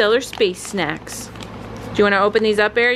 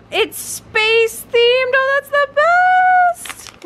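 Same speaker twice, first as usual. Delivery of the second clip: gasp, then a much higher delighted squeal; thrilled to find the space theme; 4 dB louder, slower than usual. Real, not acted. Opening the dog's space box, high delighted squeal.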